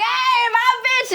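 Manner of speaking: high, drawn-out imitation of a whooping hello; mock excited greeting. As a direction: stretch it out high, hailing a best friend. Imitates friend's drawn-out greeting call.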